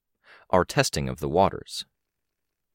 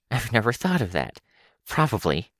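wheezy, whistling through teeth; 6 semitones higher than usual. Acted. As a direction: let air whistle past the teeth. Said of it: "a wheezy, whistling sound".

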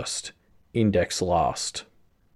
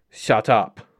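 angry; 4 dB louder than usual. Acted. Croc slurs angry warning.

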